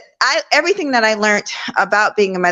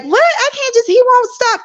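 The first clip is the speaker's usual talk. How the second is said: much higher, squeaky, frantic protest; panicked disbelief; 13 semitones higher than usual. Retold or imitated. Young mom on the phone to grandmother, high panicked protest. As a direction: cry out in alarm at being told to let him cry.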